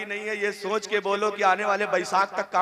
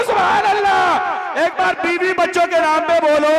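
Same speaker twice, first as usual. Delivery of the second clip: rousing call to the crowd; 7 semitones higher, 13 dB louder than usual. Acted. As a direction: whip the crowd into shouting. Preacher leads crowd chant. (भीड़ से ज़ोरदार पुकार, खींचकर)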